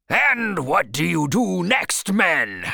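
high, loud, drawn-out rallying cry; a little wild cry. Acted. Captain goading his men for the next answer, crying out wildly. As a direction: work the crew up, a little wildly. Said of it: "he cried a little wildly".

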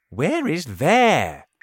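high, slow puzzled question. A narrator's reading. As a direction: ask in confusion. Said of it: "they asked".

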